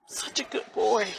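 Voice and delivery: barely voiced, breaking whisper; grief-stricken whisper. Grieving mother whispers of her dead son.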